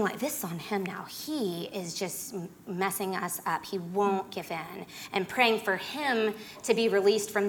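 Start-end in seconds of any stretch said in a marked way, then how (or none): 0.2-4.8 muttered under the breath, softer; softly muttered blame; inner grumble. Retold or imitated. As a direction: grumble it privately, much softer. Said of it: "And thinking".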